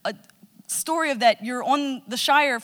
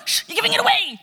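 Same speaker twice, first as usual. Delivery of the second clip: high, loud hushing chicken; playful chicken shushing the crowd, high; the chicken as a high cartoon voice; 10 semitones higher, 3 dB louder, faster than usual. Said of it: "and go".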